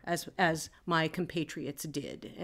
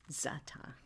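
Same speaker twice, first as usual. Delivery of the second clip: unsure whisper; 6 semitones lower, 16 dB quieter than usual. Real, not acted. Player unsure of a name, whispering it. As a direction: whisper the word Zata, unsure how it goes. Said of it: "how do you say it?".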